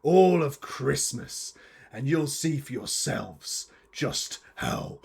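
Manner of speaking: theatrical villain monologue with deliberate pauses; bitter villainous curmudgeon. Acted. Curmudgeon explains the plot against Christmas, menacing monologue. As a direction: spit out the hatred, then recount it ominously. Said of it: "I am the Christmas curmudgeon".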